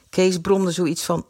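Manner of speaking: usual speaking voice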